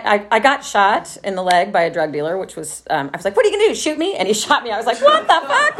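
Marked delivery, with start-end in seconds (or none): 3.3-4.1 high mocking challenge; taunting dare. Taunting the drug dealer before he shoots, high and mocking. 5.0-5.8 high laughing disbelief; stunned, half-laughing outburst; shocked. Retold or imitated. Just shot in the leg, high and laughing in shock.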